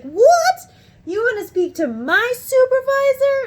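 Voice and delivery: very high, loud, incredulous squeal; imitated astonishment. Supervisor asked for his own supervisor, astonished. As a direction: mimic someone floored by the request.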